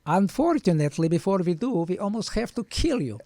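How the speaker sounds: hammed-up Russian doctor accent; Goldfinger impression. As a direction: play the doctor as a Bond villain. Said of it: "He sounds like Goldfinger".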